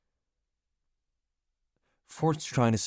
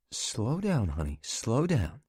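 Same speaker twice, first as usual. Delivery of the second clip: higher, slow lightly voiced woman calming him; soothing wife; 3 semitones higher, slower than usual. Catherine calms her upset friend over the phone, slow and higher. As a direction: talk him down gently.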